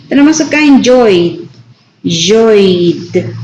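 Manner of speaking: "enjoyed" ending in a voiced D with no extra syllable. Shown as a demonstration. Teacher modelling the vowel-plus-D ending, carefully. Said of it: "So that is D".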